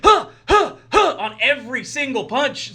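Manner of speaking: short high grunts with each punch; mock punch grunts. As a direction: grunt on every punch, hammed up.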